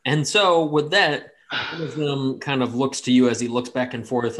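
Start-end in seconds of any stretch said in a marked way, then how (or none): none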